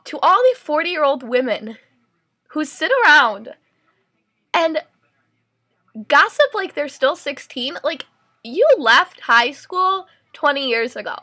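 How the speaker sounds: exasperated lecture to gossiping mothers; indignant statement. Real, not acted. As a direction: call them out from a soapbox. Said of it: "I just wanna make a statement".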